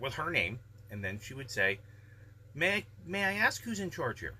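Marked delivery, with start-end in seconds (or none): 2.5-4.3 polite mom voice. Retold or imitated. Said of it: "very politely".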